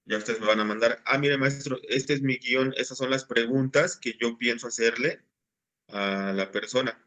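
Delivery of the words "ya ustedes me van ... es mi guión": normal talking voice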